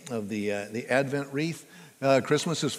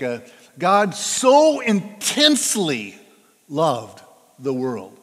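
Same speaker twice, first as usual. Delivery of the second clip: "so" drawn out and loud, slow; slow "God so intensely loved", "so" stretched; stress on so; 6 dB louder, slower than usual. Shown as a demonstration. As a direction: lean hard into "so intensely". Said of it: "means, like".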